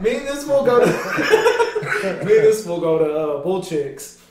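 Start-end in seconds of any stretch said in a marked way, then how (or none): 0.9-2.2 loud high-pitched burst of laughter. Real, not acted. Laughing at his friend with a high, loud burst. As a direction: crack up high.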